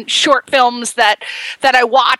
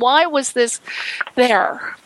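ordinary talk, nothing marked

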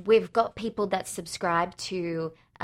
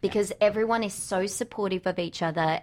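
ordinary delivery

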